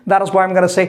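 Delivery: ordinary delivery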